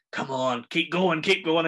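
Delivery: pressing mock coaching, building in pitch and volume; urgent egging-on. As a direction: push them on with rising urgency. Acts director urging actress to scream.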